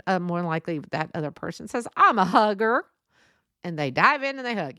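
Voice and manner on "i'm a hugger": loud, gushing imitation of a hugger; eager announcement. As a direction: announce it loudly, as if diving in for a hug.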